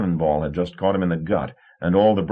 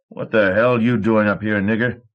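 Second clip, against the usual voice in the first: snapped; 3 semitones higher, 4 dB louder than usual. A narrator's reading. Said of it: "he snapped".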